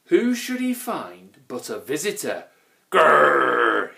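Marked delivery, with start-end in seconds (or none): 2.9-3.9 long, loud troll "grrr"; bellowed growl. A narrator's reading. Grunt finds a visitor at home, bellowing growl. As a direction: roar out a drawn-out growl. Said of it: "he bellowed".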